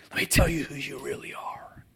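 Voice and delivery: hushed whisper of God. Acted. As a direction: breathe it close, as a secret. God speaking intimately to sinners.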